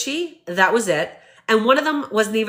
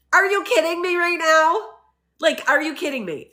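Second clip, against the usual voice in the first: incredulous; 6 semitones higher than usual. Real, not acted. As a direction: demand it in raised-pitch outrage. Her incredulous reaction to the backlash.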